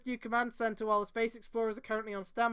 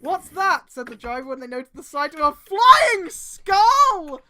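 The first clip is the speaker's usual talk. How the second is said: dramatic reading; 6 semitones higher, 12 dB louder than usual. Acted. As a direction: boom it out like a trailer.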